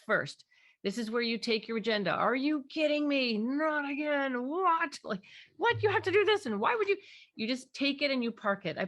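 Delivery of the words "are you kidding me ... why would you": high, louder, exasperated disbelief; exasperated parent. Retold or imitated. Parent venting own agenda at child, exasperated and high. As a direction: pitch it up in fed-up outrage.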